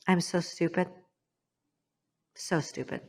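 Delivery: soft, regretful aside; self-reproach. Real, not acted. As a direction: murmur it to yourself, sinking away. Self-reproach, aside in painful story.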